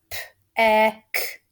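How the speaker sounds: "P-E-K-T." sounded out sound by sound. Shown as a demonstration. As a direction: tap "P-E-K-T." one sound at a time. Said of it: "Be careful with the second part of this word".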